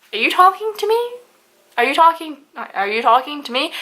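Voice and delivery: high-pitched, affronted disbelief; retold incredulous comeback; incredulous challenge. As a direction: throw it back as an indignant question. Confronting the boyfriend who called out.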